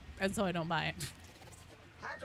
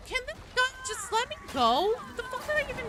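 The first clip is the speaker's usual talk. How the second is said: very high-pitched frustrated whine; high, strained gamer exasperation; exasperated complaint; faster than usual. Real, not acted. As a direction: squeak out your frustration at the game. Annoyed by gun mid-game.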